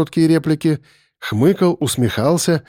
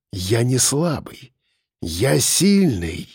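slow insistence; 3 dB quieter, much slower than usual. A narrator's reading. Captor insists on his strength.

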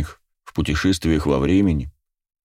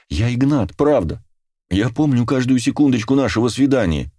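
higher-pitched, earnest coaxing; pleading reassurance; 6 semitones higher than usual. A narrator's reading. Man persuading the frightened girl he is Игнат, pleading. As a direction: soothe and plead, growing more urgent.

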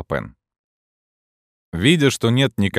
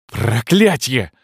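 slow, loud, anguished curse; drawn-out curse; 5 semitones higher, 4 dB louder, much slower than usual. A narrator's reading. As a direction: stretch the curse as he falls. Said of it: "воскликнул Грингуар".

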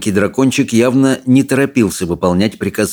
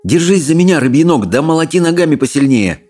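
urgent, higher order to a friend; commanding; 7 semitones higher than usual. A narrator's reading. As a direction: order him to hold on tight. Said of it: "велел Икинг".

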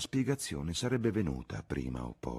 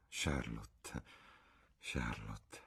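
murmured; 4 semitones lower, 9 dB quieter, much slower than usual. Acted. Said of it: "mormorò Lucy".

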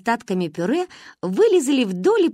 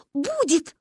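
delighted; 10 semitones higher than usual. Acted. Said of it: "обрадовались мы".

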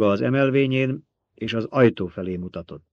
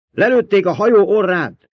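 high, full-voiced alarmed yell; 10 semitones higher, 9 dB louder than usual. A narrator's reading. Someone reports the ship hit, yelling. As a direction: bellow the bad news across the room. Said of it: "ordította valaki".

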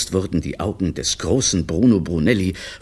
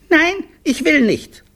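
very high, slow, loud refusal; stubborn small child; 5 dB louder than usual. Acted. Littlest refusing the director a greeting, slow stubborn squeal. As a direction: drag it out like a defiant toddler.

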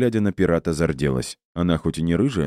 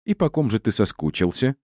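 higher, needling question; snide and probing, pitched up; caustic; 6 semitones higher than usual. Acted. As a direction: ask it with biting sarcasm. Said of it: "язвительно произнесла мама кошки".

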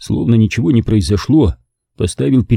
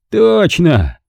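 higher, loud cut-in; eager interruption; 9 semitones higher, 3 dB louder than usual. A narrator's reading. Stanton cutting off his guest, eager. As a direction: jump in eagerly. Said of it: "прервал он".

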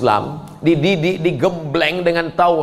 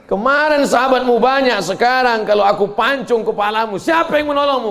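higher, louder taunting threat; threatening enemy; 6 semitones higher, 3 dB louder than usual. Acted. Enemy threatening the Prophet alone under a palm tree, taunting. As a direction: taunt him with the sword raised. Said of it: "kata Ibnu Mas'ud".

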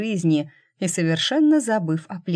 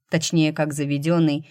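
plain reading voice

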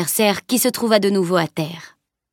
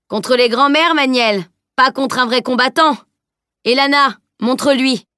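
mocking call; 5 semitones higher, 4 dB louder than usual. Teacher taunting, calling to Elana.

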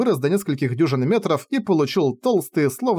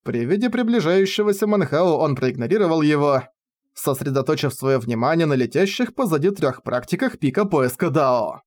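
plain reading voice

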